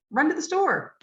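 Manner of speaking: "Run to the store" with run and store stressed, to the reduced; with prosody. Shown as a demonstration. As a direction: say it naturally with sentence rhythm. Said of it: "So if I said".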